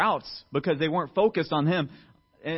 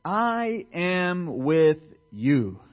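emphatic promise; 2 semitones higher, 6 dB louder, much slower than usual. Acted. Preacher giving God's promise to the congregation, building then tender. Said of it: "'Cause he says".

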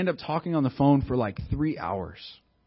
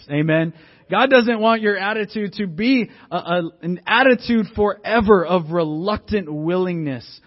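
raised, loud sermon exhortation; full-force preaching; 7 semitones higher, 7 dB louder than usual. Real, not acted. Preacher warning congregation against reluctant attitudes, emphatic preaching. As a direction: drive it home to the congregation, high and loud.

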